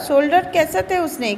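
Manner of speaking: ordinary delivery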